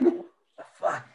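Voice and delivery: breathy stunned whisper; under his breath, floored; whispered what the fuck. Retold or imitated. Recalling the French-speaking driver, a stunned whisper.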